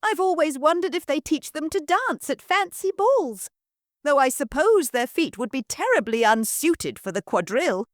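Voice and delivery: excited daughter quizzing the lord; eager girl. Acted. Marianne rambles.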